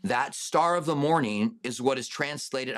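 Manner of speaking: ordinary delivery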